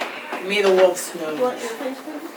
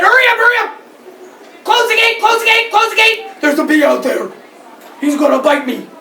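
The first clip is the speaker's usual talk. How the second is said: high, loud, urgent cries; panicked goats; 12 semitones higher, 7 dB louder, faster than usual. Acted. Goats flee the bee into the barn, panicked. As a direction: yell for the gate in a panic.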